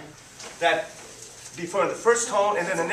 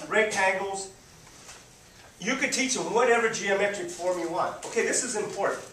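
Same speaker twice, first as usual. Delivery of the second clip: ordinary delivery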